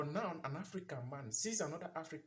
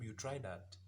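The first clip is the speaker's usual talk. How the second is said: normal talking voice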